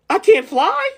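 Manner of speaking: high, outraged question; can't believe it, fling it upward; indignant disbelief. Acted.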